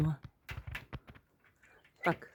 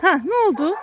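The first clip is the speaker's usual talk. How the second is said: high pet-talk question; talking to a dog; 7 semitones higher, 4 dB louder than usual. Real, not acted. Owner questions his dog after the barking, high pet-talk. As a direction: ask the dog in a raised, high voice.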